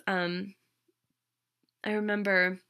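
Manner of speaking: normal talking voice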